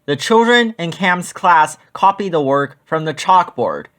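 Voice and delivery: ordinary delivery, nothing marked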